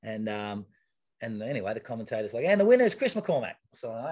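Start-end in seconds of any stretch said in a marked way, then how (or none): 2.4-3.6 a lightly voiced stage announcer, higher and louder; announcer call. Commentator announcing the race winner to the crowd, in a mild announcer voice.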